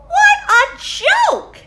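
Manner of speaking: high, slow, mocking bird voice; mocking robins. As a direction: sneer it out like a jeering little bird. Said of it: "said the robins".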